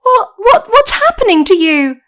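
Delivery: high, loud stammering alarm; Dorothy shocked as the witch starts melting; alarmed girl. Acted.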